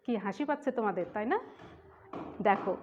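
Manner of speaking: usual speaking voice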